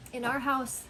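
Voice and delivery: usual speaking voice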